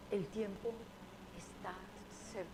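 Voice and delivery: soft, hushed El tiempo está cerca fading to almost nothing; gentle hushed delivery of El tiempo está cerca. Acted. Quoting God's words.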